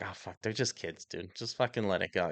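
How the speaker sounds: soft, low talk to yourself; hushed, resigned self-reminder; self-calming. Retold or imitated. Telling himself to calm down.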